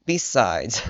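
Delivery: usual speaking voice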